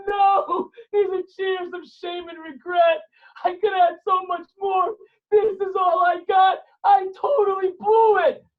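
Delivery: high, loud, slow mock wailing; hammed-up crying. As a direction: wail with exaggerated regret. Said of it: "And he starts crying".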